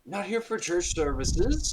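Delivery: high, slow priestly apology; fussy priest caught off guard; flustered priest. Acted. Priest greeting the party, flustered and high.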